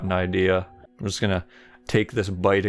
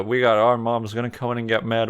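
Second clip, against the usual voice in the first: usual speaking voice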